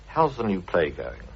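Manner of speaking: ordinary delivery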